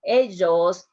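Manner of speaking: "ellos" said "ejos" with a j sound as in jam; double L as j. Shown as a demonstration. Teacher showing students the "ellos" with a j sound. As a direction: make the ll in "ellos" a hard j. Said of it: "the pronunciation of the double L".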